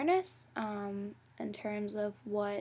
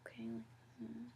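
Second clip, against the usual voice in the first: quiet muttered disbelief; 11 dB quieter than usual. Retold or imitated. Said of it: "And I was like".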